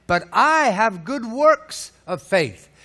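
insistent voice of the doer; challenging quote. Retold or imitated. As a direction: throw the claim back at them. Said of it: "Someone will say to you then".

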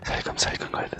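unvoiced hushed whisper; whispering while hiding. Real, not acted. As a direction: whisper so the witch can't hear.

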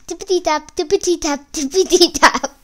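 high, loud sing-out of trotting hooves; hoof patter. A narrator's reading. Narrator sounds the goat's hooves.

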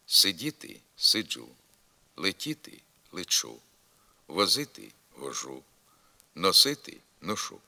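сиджу, лечу with "dzh" and "ch" in place of д, т; вожу, ношу with clear "zh" and "sh"; alternating consonants. Shown as a demonstration. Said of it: "чергуються зі звуками".

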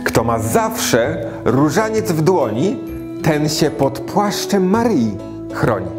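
put-on rhythmic rhyming verse for children; poem recitation. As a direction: recite it like a nursery rhyme.

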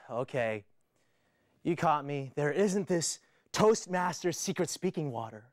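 ordinary talk

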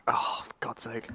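whispered exasperation; frustrated whisper. Real, not acted. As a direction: breathe it out, annoyed. Shot in the back.

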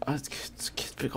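unvoiced hushed "oh shit, get over here"; whisper. Real, not acted. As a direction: whisper like you don't want it to hear. Sneaking toward spider.

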